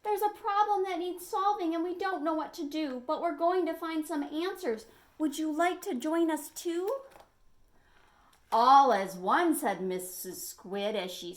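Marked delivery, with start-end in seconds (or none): 0.0-6.9 lively higher refrain. Acted. 8.5-9.5 rallying call. Acted. Missus Squid calling out.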